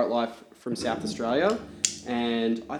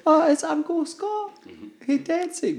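put-on much higher, slow little-girl voice; child voice; slower than usual. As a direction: squeak it like an excited child. Imitating daughter watching dancer.

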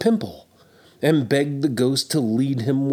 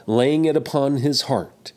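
ordinary delivery, nothing marked

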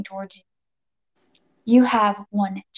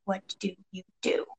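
very soft, word by word; barely voiced, each word set apart; near-whispered repeat for suspense; hushed suspense; 2 semitones lower, 11 dB quieter than usual. A narrator's reading. Repeats dilemma, softer and more drawn out.

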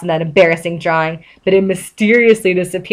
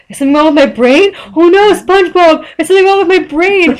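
high, loud mock panic; Patrick; 10 semitones higher, 5 dB louder than usual. A narrator's reading. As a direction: wail it in cartoon alarm, rushing at first. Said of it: "Patrick said".

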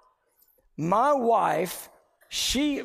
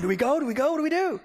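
questions climbing higher and higher; mock panic; 6 semitones higher, much faster than usual. Husband asking whether to leave for hospital, mounting mock panic. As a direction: re-enact escalating fluster, pitch rising.

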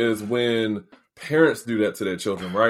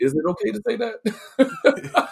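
timid question; 8 semitones higher than usual. Retold or imitated. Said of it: "And you like".

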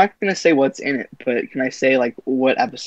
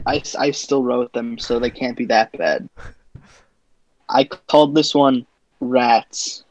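normal talking voice

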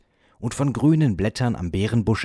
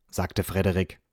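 usual speaking voice

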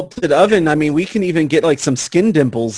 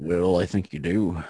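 much lower, much softer insinuation; low suggestive voice; 9 semitones lower, 9 dB quieter than usual. Acted. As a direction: drop low and sly.

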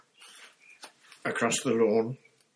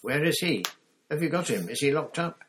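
higher, louder rush of questions; frantic boy; 9 semitones higher, 5 dB louder than usual. Acted. Harry fires questions at Moody, frantic. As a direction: press him for answers.

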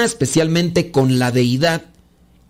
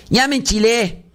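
higher, exasperated outburst; worked up; 6 semitones higher than usual. Real, not acted. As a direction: blow up in mock irritation.

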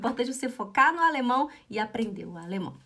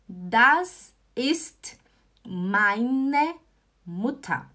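"Das" hit hard, then "meine" with a rise and "Mutter" falling; teacher stressing "Das" for the class; careful repetition; 3 dB louder, much slower than usual. Shown as a demonstration. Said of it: "Aí a resposta é".

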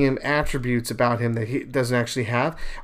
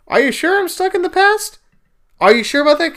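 much higher, incredulous question; sceptical retort; 5 dB louder than usual. Acted. Answering critics who call him stuck, high-pitched scepticism. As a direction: challenge them with exaggerated doubt.

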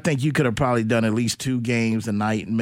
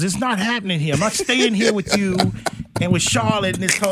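a lightly voiced, raised-pitch movie character; replaying the Departed-style farewell line, higher; host mimics a movie character's farewell, mildly higher; movie scene quote; 6 semitones higher than usual. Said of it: "He goes".

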